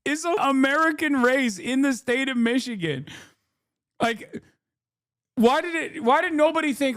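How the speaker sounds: very high, incredulous complaint; exasperated outburst. Real, not acted. Streamer objecting to an Israeli take on Michigan, high exasperated outburst. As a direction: protest way up high, as if baffled.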